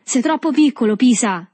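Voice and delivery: high, loud mocking taunt; beffardo. A narrator's reading. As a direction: taunt the younger boy loudly. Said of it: "disse il Tortima beffardo". (presa in giro acuta e beffarda)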